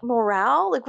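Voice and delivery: normal talking voice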